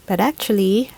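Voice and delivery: usual speaking voice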